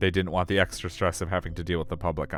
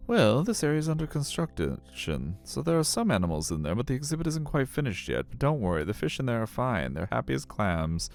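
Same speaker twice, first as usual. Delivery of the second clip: higher, swooping character voice; lilting put-on voice, placating a visitor; reassuring guide character; 5 semitones higher than usual. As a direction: explain it away in a higher-pitched guide voice. Voices game character answering question.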